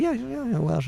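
high-pitched mimicry, softly insisting; imitated insistent woman. Visitor insisting to the doubter, high imitated woman. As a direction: brush the question off in her high voice.

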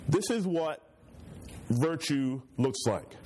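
usual speaking voice

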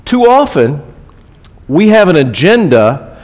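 high impassioned pulpit outburst; going off. Real, not acted. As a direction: launch into the rant. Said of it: "the privilege of going off".

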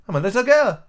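high, doubtful little claim. Acted. Alice telling the pigeon what she is, doubtful. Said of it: "said Alice rather doubtfully".

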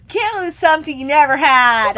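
loud, high mock wail; hammed-up imitation rising into laughter; weirdly overwrought. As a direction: blurt it out in exaggerated anguish. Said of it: "But she says it in a way, it's like".

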